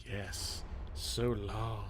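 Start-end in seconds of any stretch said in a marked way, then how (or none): none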